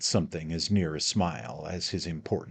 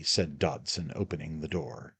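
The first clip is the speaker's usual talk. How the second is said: ordinary delivery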